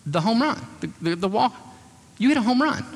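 high, eager boasting about the home run; boastful excitement. Retold or imitated. A young man to his date after the game, eagerly boasting. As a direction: fish hard for her praise, pitch climbing.

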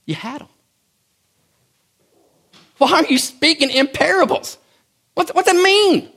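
starts hushed, climbs to a loud high protest; exasperated disbelief. Retold or imitated. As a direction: build from a stunned murmur to an incredulous cry. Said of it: "They're saying".

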